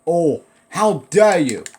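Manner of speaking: slow, loud outraged cry; shaking with anger. Pretend. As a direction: drag out the outrage. Outraged exclamation at game moment.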